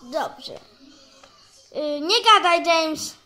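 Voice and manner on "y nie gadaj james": louder, scolding like a play teacher; pretend teacher yelling. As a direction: tell the pupil off loudly. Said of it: "niech pan na mnie nie krzyczy".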